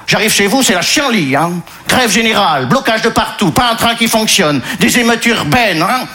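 a hint of a Congolese accent, complaining; Congolese-Belgian accent. Disappointed migrant complains to Régis in a light Congolese Belgian accent. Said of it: "Il vient du Congo belge".

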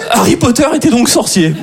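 high-pitched mock revelation; amazed reader. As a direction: announce the obvious as a huge discovery. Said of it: "je suis là, wow!".

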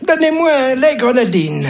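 put-on high-pitched frail old man voice; tiny old man. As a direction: play a puny little old man, high. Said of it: "le vieux, il est tout fluet".

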